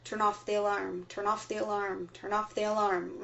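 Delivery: mild mock trance chant; repeating loop. Host imitating induction victims, mock looping chant. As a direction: drone the phrase over and over. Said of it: "reported this feeling as a repeating loop".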